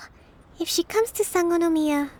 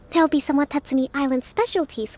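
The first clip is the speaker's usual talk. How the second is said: ordinary talk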